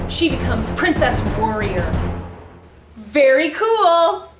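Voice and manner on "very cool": usual speaking voice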